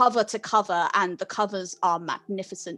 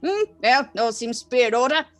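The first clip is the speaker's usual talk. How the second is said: put-on old man, louder and raised; older male librarian; 4 semitones higher, 6 dB louder than usual. Librarian approves student's card, raised elderly voice. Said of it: "He says".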